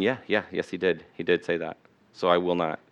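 firm answer. Retold or imitated. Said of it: "Eve's answer should have been".

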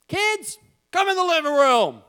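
put-on high, loud call to the kids; old-sitcom parent calling. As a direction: holler through the house like a TV parent. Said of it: "that sounded like Leave It to Beaver".

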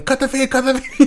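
frantic, high-pitched alarm; urgent mock panic; panicked. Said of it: "panicking, going".

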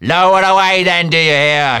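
high, thunderous captain's order; full shouted command; shouting across deck. A narrator's reading. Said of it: "shouting across the deck".

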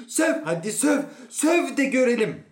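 high, loud goading shout; shouting dare. A narrator's reading. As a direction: goad him on at full voice. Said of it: "rahatlamak için bağırıyordu".

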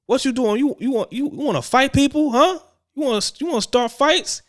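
high, shrill-pitched angry mom imitation; angry mother. The kid's mother confronting her son in the hallway, a high mock scolding.

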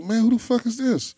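high, startled retold shout; mock alarm at the door. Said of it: "I was like".

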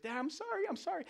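put-on very high little-kid apology; squeaked, high-pitched pleading; pleading child. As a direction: imitate a child whining sorry to Dad. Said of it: "He's like".

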